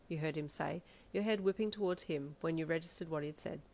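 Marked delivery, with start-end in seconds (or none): none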